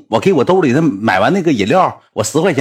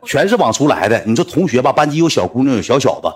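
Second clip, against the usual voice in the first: ordinary talk, nothing marked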